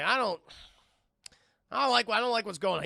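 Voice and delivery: whining dog voice. Retold or imitated. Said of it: "He's, like, whining".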